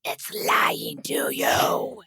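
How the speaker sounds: slow, rasping whispered gremlin. Acted. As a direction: creep it out like a sly gremlin. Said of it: "It's my gremlin voice".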